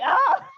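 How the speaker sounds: high loud shriek; mock terrified shriek; startled scream. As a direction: scream like seeing a giant rat. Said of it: "she's just like".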